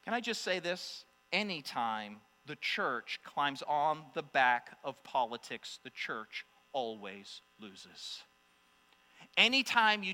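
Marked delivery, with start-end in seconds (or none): 1.3-8.2 lower, slow and soft on "always loses"; solemn warning. Real, not acted. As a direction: let it land heavy.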